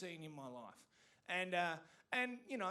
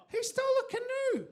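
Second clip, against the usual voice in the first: much higher, loud, laughing; louder laughing shout; 11 dB louder than usual. Acted. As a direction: shriek it even higher in disbelief. Repeated punchline, laughing.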